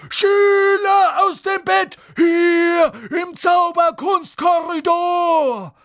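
very high, loud, drawn-out alarm yell; Peeves bellowing. A narrator's reading. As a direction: raise the alarm in a piercing poltergeist shout. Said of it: "brüllte Peeves".

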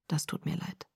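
faint unvoiced whisper; whispered apology. Edward apologizing to Bella, whispered. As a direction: barely breathe the apology.

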